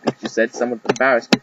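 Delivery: ordinary delivery, nothing marked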